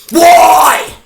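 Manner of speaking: very loud, high-pitched yell; screamed why. Acted. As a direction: explode in a full-force scream. Screams why amid rant.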